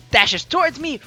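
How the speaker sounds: ordinary delivery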